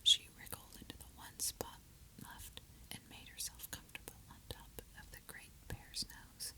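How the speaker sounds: usual speaking voice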